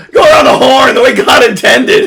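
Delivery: high, loud laughing proclamation; laughing shout. Acted. Host mock-proclaims the proper shipping route, laughing and loud. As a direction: bellow it while cracking up.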